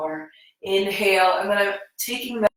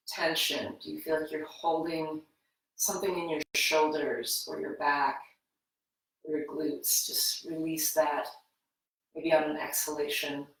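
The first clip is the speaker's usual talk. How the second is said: guided meditation; 5 dB quieter than usual. Acted. Yoga teacher guiding students into breath awareness, soft meditative voice. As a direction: ease them into their breath gently.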